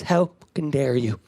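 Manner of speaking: "How fucking dare you" with "fucking" dropped to a silent gap; muted swear word. Retold or imitated. Mother snapping at her son, "fucking" silently swallowed. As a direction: mute the word "fucking", leaving a tense pause before "dare you". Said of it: "She mutes herself".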